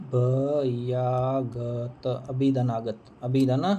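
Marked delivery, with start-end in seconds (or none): 0.1-2.1 अभयागत stretched out very slowly, syllable by syllable; अभयागत pulled apart slowly for the class; slow pronunciation demo. Shown as a demonstration. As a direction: spell out अभयागत aloud, very slowly. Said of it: "अभ्यागत होगा".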